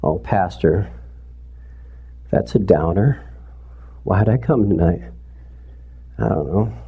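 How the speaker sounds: lightly voiced low, deflated listener; mock churchgoer grumble. Churchgoer complains to pastor about tonight's sermon, mildly mock grumbling. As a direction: drop low and soft for a mild mock complaint.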